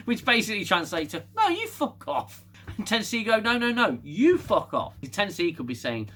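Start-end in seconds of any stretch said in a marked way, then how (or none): 1.4-2.4 high-pitched squabbling retort; mock indignant comeback. Ship answering the aliens' insult, mock indignant and high. Ship's translated retort, acted. 3.4-4.9 high, loud bickering comeback; mock escalating squabble. Aliens firing the insult back at the ship, mock indignant.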